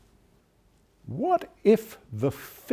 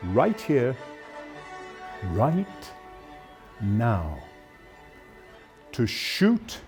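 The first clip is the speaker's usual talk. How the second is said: halting, weighty, long pauses between phrases; slow, deliberate challenge; 3 semitones lower, slower than usual. Acted. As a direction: spell out the grave choice, piece by piece. Tense dramatic challenge to shoot.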